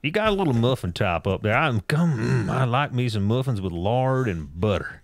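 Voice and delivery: folksy put-on Southern accent; hammed-up Southern voice; Southern guy. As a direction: play a down-home Southern guy. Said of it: "Southern guy".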